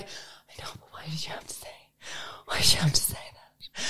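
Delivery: very hushed whispered reproach; mortified whisper. Retold or imitated. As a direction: whisper the complaint under your breath. Mortified aside to outspoken companion.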